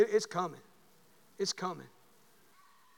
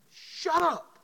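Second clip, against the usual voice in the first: snapped shut up; 9 semitones higher, 5 dB louder than usual. Retold or imitated. Retold snapping at daughter.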